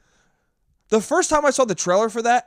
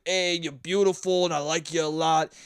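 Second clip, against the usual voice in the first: dumb Italian husband; 4 semitones lower, 4 dB quieter than usual. Retold or imitated. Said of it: "if he's that much of a moron, right, where he's like".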